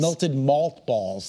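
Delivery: each syllable slowly spelled out; slow down to get it right; slow careful. Shown as a demonstration. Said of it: "why can't I say that?".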